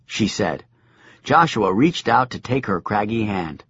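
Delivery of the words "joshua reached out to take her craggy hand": ordinary delivery, nothing marked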